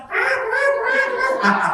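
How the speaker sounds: high mock shrieks of a wife; a husband imitating his wife's high shrieks; imitated wife's shriek. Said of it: "kalau istri teriak".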